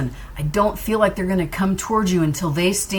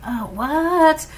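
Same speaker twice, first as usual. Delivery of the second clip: surprised; 10 semitones higher than usual. Retold or imitated. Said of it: "you know, like".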